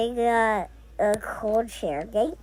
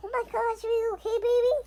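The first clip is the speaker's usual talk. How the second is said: caring; 8 semitones higher, 4 dB quieter, much faster than usual. Pretend. Comforting a pretend baby.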